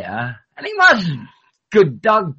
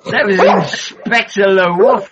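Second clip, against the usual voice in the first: loud, drawn-out comic inspector; mock inspector; 3 semitones higher, 5 dB louder than usual. Dog inspector reporting on the emergency, hammed-up. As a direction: announce it slowly in a hammed-up voice.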